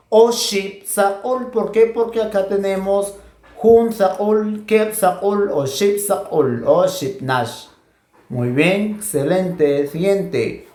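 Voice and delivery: ordinary delivery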